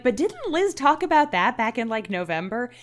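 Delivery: imagined listener. Acted. Host playing listeners challenging her about an earlier mention, lightly voiced objection. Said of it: "For your listeners who are like".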